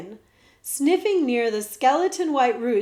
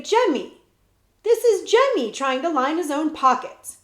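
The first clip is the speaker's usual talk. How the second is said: mock tutor; 6 semitones higher, 6 dB louder than usual. Jemmy imitates the tutor.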